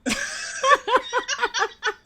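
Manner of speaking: high cackling laugh; loud burst of laughter. Real, not acted. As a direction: explode into laughter.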